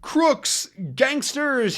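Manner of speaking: high furious yells of the words; yelling after the gangsters' car. Acted. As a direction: hurl the insults after the car. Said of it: "he yells".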